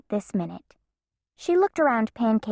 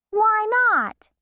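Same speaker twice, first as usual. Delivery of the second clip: put-on much higher, pleading child; whiny child; 12 semitones higher, 3 dB louder than usual. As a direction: whine it like a kid. Bean disappointed.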